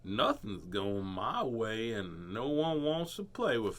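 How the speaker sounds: very slow, soft sad voice; slow sad mope; sad alligator. Acted. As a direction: drag each word out mournfully. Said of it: "did not look so happy".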